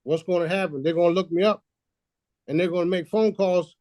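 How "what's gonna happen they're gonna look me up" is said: ordinary talk, nothing marked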